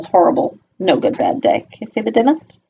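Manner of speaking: plain reading voice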